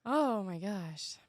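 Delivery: ordinary talk, nothing marked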